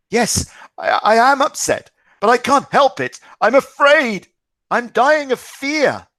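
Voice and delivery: high, loud, desperate fear; almost in tears. Acted. Distraught father admitting his fear to his son, near tears. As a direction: cry out that you are terrified. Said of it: "Beside himself and almost in tears".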